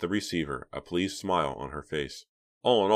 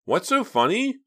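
put-on little boy; 7 dB louder than usual. A narrator's reading. Six-year-old brother barges in.

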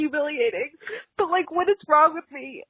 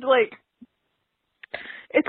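ordinary talk, nothing marked

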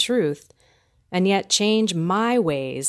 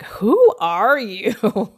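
slow, louder stunned question; amazed disbelief; 3 semitones higher, 6 dB louder, much slower than usual. Retold or imitated. Old friends marvelling at how she changed, slow stunned question. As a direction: act like you don't recognize her.

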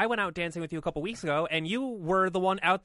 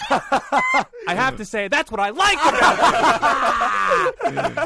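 laughing mock; 5 semitones higher, 7 dB louder, much slower than usual. Mocking the quoted text.

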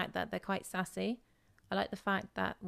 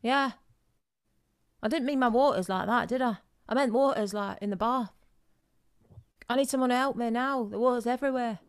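put-on raised, insistent English-accented voice; 4 semitones higher, 5 dB louder than usual. Caller begs front desk for help with water, raised English accent. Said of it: "That was a really good accent".

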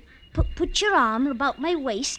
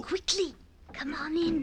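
hushed; 7 dB quieter than usual. Acted. Parents asleep, sneaking lover in.

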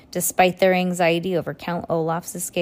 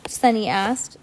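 usual speaking voice

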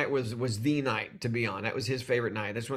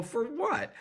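surprised; 13 semitones higher, 5 dB quieter than usual. Retold or imitated. Surprised reply to producer.

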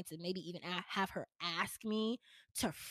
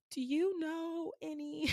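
timid question; 8 semitones higher, slower than usual. Retold or imitated. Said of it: "I was like".

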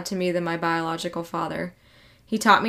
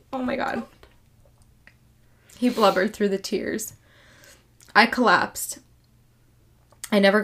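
choked up. Real, not acted. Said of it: "I'm getting so choked up".

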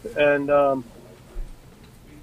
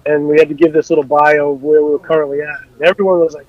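normal talking voice

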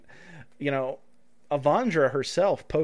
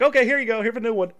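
higher, louder deflated handover; mock rep from sketch; 8 semitones higher, 6 dB louder, faster than usual. Host retelling a sketch's customer service guy, higher and deflated. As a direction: hand it over high and sighing.

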